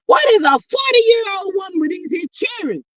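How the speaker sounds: high, indignant shout of disbelief; outraged outburst. Real, not acted. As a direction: cry out in real outrage at the story. Outraged at news story.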